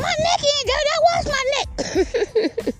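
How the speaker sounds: squeaky falsetto, stretched at the end; indignant little dog. Acted. Little dog defending its clean neck, high indignant squeal. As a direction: protest in a high, wounded squeal. Dog answers tiger's insult.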